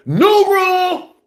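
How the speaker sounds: high, drawn-out announcement; emphatic proclamation. Acted. Fan lays down new rule, high slow proclamation. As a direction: stretch it out like a decree.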